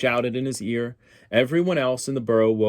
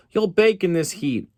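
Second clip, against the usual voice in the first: high, louder worried aunt; fretful cry; 9 semitones higher, 5 dB louder than usual. Acted. Aunt Sassy fretting over Phyllis in heat, high cry. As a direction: cry out in fussy alarm. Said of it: "Aunt Sassy cried".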